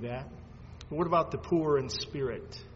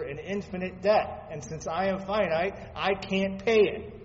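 ordinary talk

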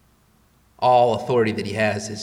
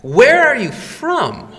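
much higher, slow urgent question; Pilate asking; 11 semitones higher, 6 dB louder, slower than usual. Retold or imitated. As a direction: press him, pitched high and slow. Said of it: "Pilate asks".

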